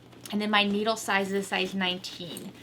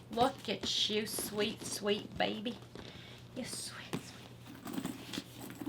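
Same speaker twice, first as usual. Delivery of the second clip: soft cooing; 11 dB quieter, much slower than usual. Real, not acted. Crafter admiring the new bag, cooing softly.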